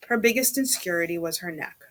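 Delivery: usual speaking voice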